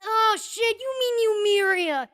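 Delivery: high, loud burst of realization; loud recognition. Acted. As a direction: exclaim it as the penny drops. Shopkeeper recognizes the name.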